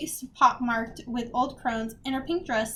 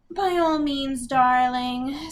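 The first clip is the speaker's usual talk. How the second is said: mock-gracious villain; 4 dB louder, slower than usual. A narrator's reading. Sophie taunts Agatha with fake courtesy, mock-gracious villain. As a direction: grant it with lofty false sweetness. Villain mockingly gives permission.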